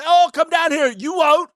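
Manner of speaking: mocking a contestant daring him to come down; taunting mock voice. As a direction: egg him on in a high teasing voice.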